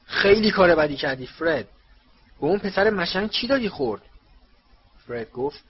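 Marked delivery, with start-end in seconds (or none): 0.1-4.0 loud, raised-pitch scolding; angry shout. A narrator's reading. Mr. Weasley scolds his son Fred, shouting angrily. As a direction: shout it as an outraged telling-off. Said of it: "او فریاد زد".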